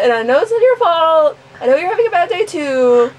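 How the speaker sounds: hammed-up plea climbing in pitch; melodramatic plea. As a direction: start near normal, build to a high, pleading wail. Acted complaint about an interrupted moment.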